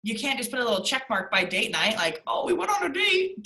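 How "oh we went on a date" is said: high, singsong mock-dutiful voice; mock box-ticking spouse. Couple ticking date night off the list, mock-dutiful. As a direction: put on a pitched-up, perfunctory cheer.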